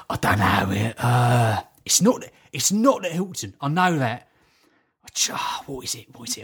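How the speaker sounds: hesitant impression, groping for a name; Terry Venables. Man trying to remember his hotel, imitated halting musing. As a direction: imitate a man thinking aloud, trailing to a hush. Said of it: "I'm walking with Terry Venables".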